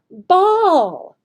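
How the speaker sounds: high, loud, put-on ball; playful voice. Shown as a demonstration. Teacher modeling for the student, high and loud. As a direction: sound it out playfully for kids. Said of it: "vary your voice".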